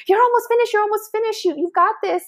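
high, eager encouragement from strangers; cheering strangers. Retold or imitated. Strangers near the finish cheering on a runner, high and eager. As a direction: cheer her on like a supportive stranger.

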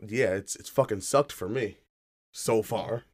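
ordinary talk, nothing marked

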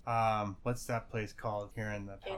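normal talking voice

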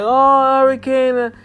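high, loud mock surprise at the news; mock clueless surprise. Mocking clueless listeners, high and loud. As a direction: play dumb, as if the hurricane is news. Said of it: "why act like we so clueless".

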